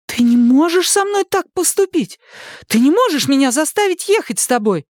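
high-pitched desperate objection; outraged protest. A narrator's reading. Daughter objects to being taken away, outraged protest.

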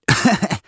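high burst of laughter; short laugh. A narrator's reading. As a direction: laugh out loud. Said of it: "засмеявшись".

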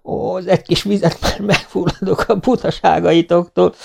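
high, laughing woman's voice; kacagástól fuldokolva. A narrator's reading. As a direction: talk as if choking with laughter. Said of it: "két kacaj között". (nevetéstől fulladozó, magas hang)

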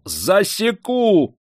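high, long-drawn yell; furious master's drawn-out holler; yelled threat. A narrator's reading. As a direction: howl the threat, stretching the word. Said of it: "заорал".

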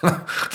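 breathy dismissive laugh; short scoffing laugh. Acted. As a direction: laugh off the idea. Friend scoffs at absurd suggestion. (kort spottend lachje)

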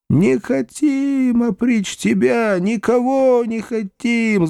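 slow, high-pitched drawn-out wailing; long, high imploring cries; peasant chorus wail. A narrator's reading. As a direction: wail it out like a pleading crowd. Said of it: "завопили вдруг мужики целым хором".

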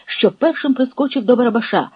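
usual speaking voice

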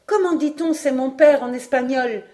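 voix de garçon, plus haute; enfant pressant. A narrator's reading. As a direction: demander vivement, d'une voix d'enfant. Robert, enfant, questionne vivement Paganel. (garçon impatient, voix aiguë)

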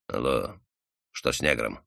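low, hushed query; вполголоса. A narrator's reading. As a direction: ask discreetly through a window. Secretive query through a window. (тихо, по секрету)

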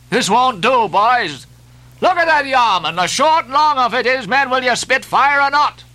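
high-pitched, goading harangue; crying to the crew. A narrator's reading. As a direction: egg your rowers on to pull harder. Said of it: "cried Stubb to his crew".

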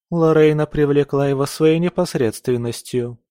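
ordinary delivery

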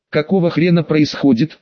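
plain reading voice

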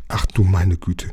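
ordinary delivery

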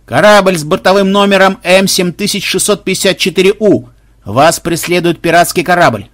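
loud, high, level announcement; megaphone hail. A narrator's reading. Pirate hailing another ship with a megaphone, loud announcement. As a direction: proclaim it officially across the distance. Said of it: "взобрался Махмуд с рупором".

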